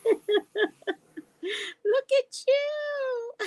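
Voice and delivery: high, slow squeal of delight with laughter. Real, not acted. She squeals and laughs, delighted by her new order. Thrilled by arrived package.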